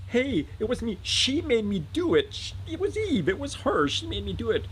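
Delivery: high-pitched, whiny protest; frantic, high self-defence; panicked blaming. Acted. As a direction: squeal the blame onto someone else. Adam frantically shifting blame onto Eve.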